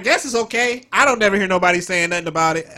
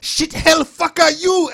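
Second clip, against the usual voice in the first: mimicked foreigners cussing; 9 semitones higher, 6 dB louder, slower than usual. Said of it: "They just say".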